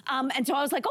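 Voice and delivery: ordinary delivery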